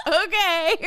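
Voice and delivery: laughing mock agreement. Laughing retort to her son.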